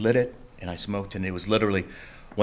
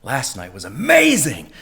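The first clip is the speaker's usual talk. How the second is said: higher, raving about the club; excited buddy; 8 semitones higher than usual. Retold or imitated. Buddy raving to the sleepy teller about the club, higher. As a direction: gush about last night. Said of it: "and he goes".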